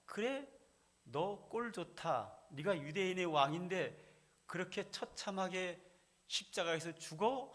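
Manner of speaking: high-pitched jeer at a condemned man; mock taunting. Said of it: "하고 놀리는 거예요".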